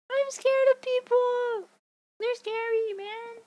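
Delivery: high, drawn-out comic whine of fear; hammed-up scared cry; mock wail. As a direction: wail it in exaggerated fright, trailing off. Wails comic fear of people.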